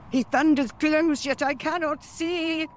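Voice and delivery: high, loud witch cry; high alarmed witch voice; alarmed hag. Acted. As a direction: cry out in a panicked crone voice.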